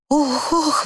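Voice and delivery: high startled cry; flustered, high-pitched dismay; alarmed exclamation. A narrator's reading. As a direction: gasp it out as if shielding yourself. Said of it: "воскликнула Люси".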